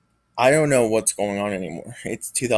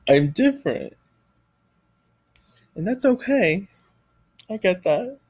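fighting back tears; 4 semitones higher than usual. Real, not acted. Tearful confession of being bullied.

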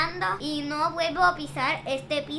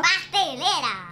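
"Pastelera" said louder and slowly, each syllable clear; 5 dB louder, slower than usual. Real, not acted. Asked to repeat, the girl says "Pastelera" loud and slow. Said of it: "Repítelo una vez más".

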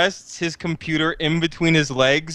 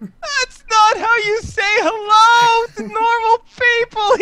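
high, slow, whiny scolding; flustered nerd; 5 dB louder than usual. Acted. August scolding Mila, high and flustered. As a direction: squeak it out, offended.

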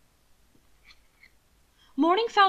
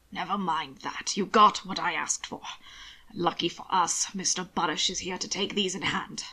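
understated, slightly lowered gentleman's voice; lightly voiced master; 4 semitones lower, 4 dB quieter than usual. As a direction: brush off the apology drily. Roland reassures his man.

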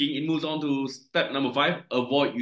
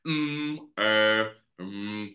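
hesitant "hmm, eh" fillers; demonstrated filler sounds; 4 semitones lower, much slower than usual. Shown as a demonstration. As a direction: hum and haw to show what fillers are. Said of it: "Fillers ni ialah bunyi-bunyi".

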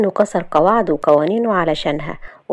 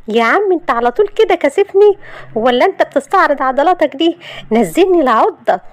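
high, loud, embarrassed protest; indignant Shahd; 9 semitones higher, 4 dB louder than usual. Acted. Shahd demanding Yousef put her down, indignant. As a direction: protest shrilly while being carried.

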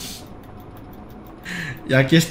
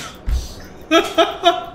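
high breathy laugh. Real, not acted. Laughs at his own joke.

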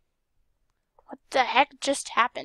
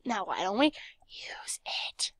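slow whisper; 3 semitones lower, 6 dB quieter, slower than usual. Real, not acted. Aside while playing.

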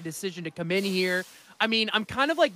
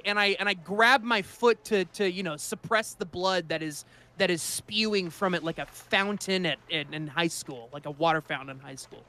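ordinary talk

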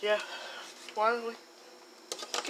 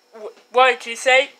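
usual speaking voice

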